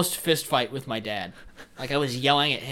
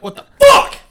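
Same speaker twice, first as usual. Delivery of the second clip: sudden much higher, louder yell; outraged shout; 15 dB louder than usual. Retold or imitated. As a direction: blow up at the moved TV. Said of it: "I'd be like".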